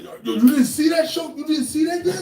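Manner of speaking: high, loud mock yell of disbelief; mock outraged fan. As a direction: act the fan badgering you in your face. Imitating someone begging in your face.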